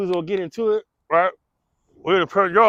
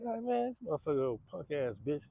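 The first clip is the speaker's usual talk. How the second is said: muttered much softer; under his breath; 12 dB quieter than usual. Retold or imitated. Insulting the brother under his breath, muttered. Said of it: "under my breath saying".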